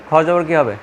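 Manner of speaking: ordinary talk, nothing marked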